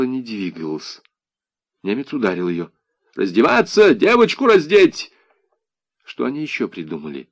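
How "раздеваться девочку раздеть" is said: loud, high soldier's command; shouted order. A narrator's reading. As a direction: order them harshly at a shout. The German repeats his shouted order. (громкий окрик солдата)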